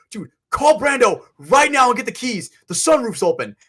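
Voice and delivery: high, loud panicked orders; freaking out. Retold or imitated. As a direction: imitate a friend frantically yelling for help. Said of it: "Cedar's freaking the fuck out".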